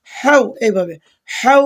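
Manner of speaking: হাউ with a narrow, throaty ha sound. Shown as a demonstration. Teacher demonstrating the throat letter ha, narrow throaty sound. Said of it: "হা গলা সরু শব্দ করে বলবেন".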